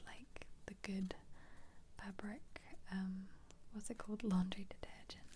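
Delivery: ordinary talk, nothing marked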